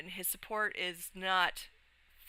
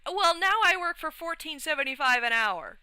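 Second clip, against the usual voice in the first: bright excited announcement, pitched higher and louder; bursting to share the good news; 9 semitones higher, 5 dB louder than usual. Real, not acted. Excited about her new hourly wage, higher and louder.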